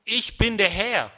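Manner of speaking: slow, loud proclamation; not quiet. Acted. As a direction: declare it with full authority. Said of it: "Dann sagt er das nicht leise".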